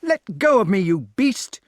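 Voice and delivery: high, loud struggling protest; furious boy's shout; crying out. A narrator's reading. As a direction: yell as if fighting a grip. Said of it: "he cried".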